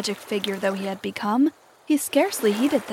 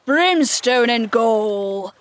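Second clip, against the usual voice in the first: slow, loud thundering oath; 5 dB louder, much slower than usual. A narrator's reading. Hook rages at his crew, thundering slowly. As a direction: thunder it out, every word drawn long. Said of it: "thundered Hook".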